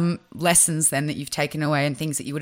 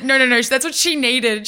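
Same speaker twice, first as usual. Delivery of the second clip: amused insistence; 7 semitones higher, 4 dB louder than usual. Retold or imitated. Defending herself, laughing.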